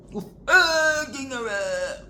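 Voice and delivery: high, loud, slow taunting yell; mock challenge. Taunting the policeman to arrest her, in a mock yell. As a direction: drag it out, daring them.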